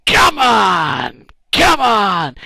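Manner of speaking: three men bellowing encouragement together; cheering shout. Retold or imitated. Moses and two helpers cheering the army on, loud shout. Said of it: "all three of them going".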